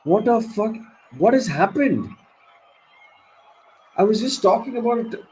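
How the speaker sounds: can't believe what just happened, voice jumping up; real fan in shocked disbelief. Real, not acted. Shocked reaction to the wicket.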